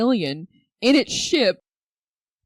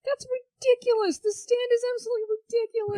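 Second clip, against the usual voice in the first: high-pitched incredulous objection; mock outrage; 13 semitones higher, 3 dB quieter than usual. Real, not acted. Podcaster rejecting a friend's movie picks, incredulous. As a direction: dismiss it in a high, indignant voice.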